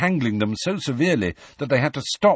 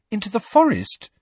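much higher, puzzled question; perplexed; 13 semitones higher, 4 dB louder than usual. A narrator's reading. Hermione questions where Hagrid is heading, perplexed. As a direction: question it in disbelief.

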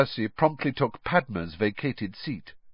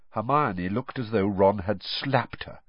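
usual speaking voice

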